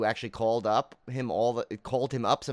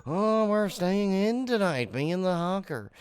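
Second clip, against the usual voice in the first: higher, slowed, showy radio-host greeting; radio sportscaster impression; 8 semitones higher, slower than usual. Podcast host imitating favorite sportscaster's on-air greeting, hammed up. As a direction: ham up a late-night sports radio sign-on. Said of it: "He'd go".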